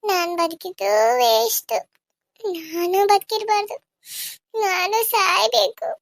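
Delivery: grieving woman telling friend she wants to die; despairing grief. Acted. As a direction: drag each word out as if nothing is left.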